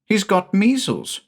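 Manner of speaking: lightly voiced young son; little boy Jamie. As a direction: ask like a small boy puzzled.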